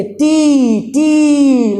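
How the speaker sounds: टी with a long, held "ee"; drawn-out syllable. Shown as a demonstration. Teacher showing the vowel at the end, टी stretched long. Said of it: "last में ई आ रहा है ना".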